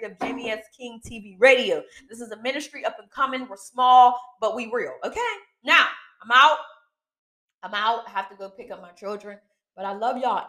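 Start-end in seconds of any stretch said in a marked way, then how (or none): none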